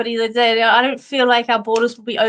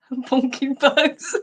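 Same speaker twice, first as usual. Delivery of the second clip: slow, giggling through the words; real laughter breaking up the nickname; chuckling it out slowly; laughing; 3 semitones higher, much slower than usual. Real, not acted. Repeats nickname while laughing.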